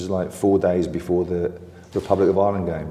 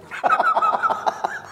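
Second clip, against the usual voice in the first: high laugh. Real, not acted. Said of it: "burst out laughing".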